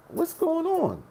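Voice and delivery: high confused what's going on; baffled. Retold or imitated. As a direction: ask it as if nothing makes sense. Confused arrival at a guarded gate.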